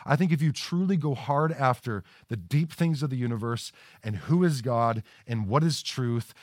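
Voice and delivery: ordinary talk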